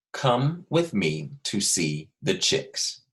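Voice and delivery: very slow, every word spaced out; dictation pace. Shown as a demonstration. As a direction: dictate slowly so children can write. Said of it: "you are going to write".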